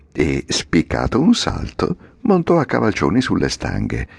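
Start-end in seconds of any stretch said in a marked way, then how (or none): none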